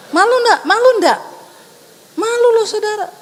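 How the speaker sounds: challenging call. Real, not acted. Preacher challenging congregation.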